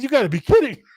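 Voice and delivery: high, loud mock disbelief; incredulous. Craig told to let go, loud high disbelief. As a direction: imitate an exasperated, disbelieving fighter.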